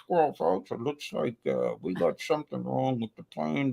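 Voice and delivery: a hint of a laid-back captain announcing a delay; airline captain voice. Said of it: "why they talk like that".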